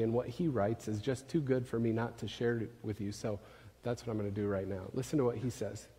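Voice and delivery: ordinary delivery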